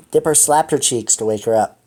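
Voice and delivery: normal talking voice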